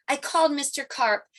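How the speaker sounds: ordinary delivery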